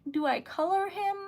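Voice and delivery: normal talking voice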